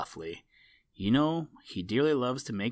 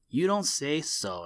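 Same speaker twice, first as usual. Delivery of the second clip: higher, louder feigned astonishment; mock surprise; 6 semitones higher, 5 dB louder than usual. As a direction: pretend to be amazed. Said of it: "exclaimed Blacky, as if he were very much surprised".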